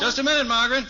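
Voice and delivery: called out loud and high to another room; projecting call. Acted. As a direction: raise the voice so she hears from afar. Calling to wife in another room.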